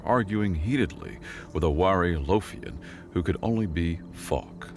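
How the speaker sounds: usual speaking voice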